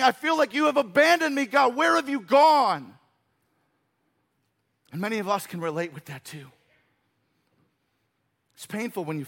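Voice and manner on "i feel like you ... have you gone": crying out. Acted. Jesus as a human feels abandoned by God, pained cry. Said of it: "was crying out".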